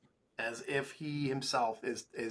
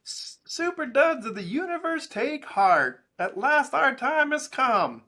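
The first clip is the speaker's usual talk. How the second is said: higher, louder, slow booming villain proclamation; Doctor Doom voice; 12 semitones higher, 9 dB louder, slower than usual. Acted. Host reads the Doom ad in a hammed-up villain voice. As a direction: proclaim it like a hammy comic supervillain. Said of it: "I'll, I'll do it".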